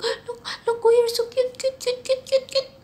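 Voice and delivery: high cutesy cooing; high gushing. Real, not acted. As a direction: gush over the cute cat up high. Gushing over cat.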